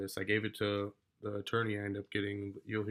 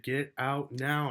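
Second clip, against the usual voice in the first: slow, louder retold angry order; mad yelling; 5 semitones higher, 5 dB louder, much slower than usual. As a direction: order them out, raised and drawn out. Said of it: "And I start yelling. I'm mad now".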